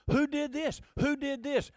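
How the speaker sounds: high-pitched, insistent questioning; God interrogating a mortal; challenging. Acted. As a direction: demand an answer, pitched well up. Voicing God's rhetorical questions to Job.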